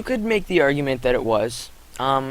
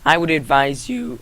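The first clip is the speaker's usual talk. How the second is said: voice crack; 3 semitones higher, 4 dB louder than usual. Real, not acted. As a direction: voice cracking on the last word. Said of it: "that was just an awful voice crack".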